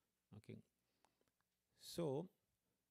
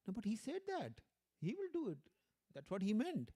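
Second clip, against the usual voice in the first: high-pitched insistence, arguing with himself; confused inner debate; 8 semitones higher than usual. Retold or imitated. Disciple debating with himself over his guru's words, insistent. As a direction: push back at his own doubt in a raised pitch. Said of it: "like a confusion in his mind".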